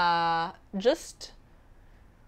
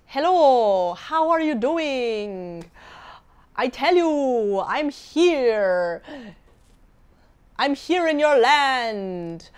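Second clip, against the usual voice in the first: imitating a teen girl faking an Arabic accent; mock Arabic accent; 3 dB louder, slower than usual. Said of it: "a real Arabic accent".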